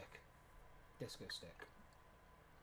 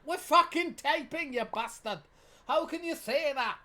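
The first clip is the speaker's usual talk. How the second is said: high, loud, slow mock indignation; mock outrage; 10 semitones higher, 9 dB louder than usual. Scolding friend for hanging up, in loud mock outrage. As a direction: ham up being offended.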